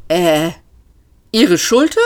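plain reading voice

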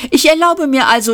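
a quick servile chirp; hurried waiter. Acted. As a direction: rush the offer.

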